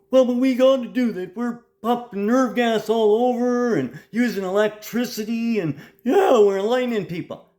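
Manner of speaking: high, loud mocking voice of the accused; mocking the torturers. Mimicking those he accuses of torture, high mocking voice. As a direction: parody them in a high, put-on whine.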